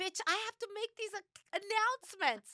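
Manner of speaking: very high-pitched mock outrage; indignant retort. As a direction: snap back in a squealed, exasperated protest. Acted retort to a complaint.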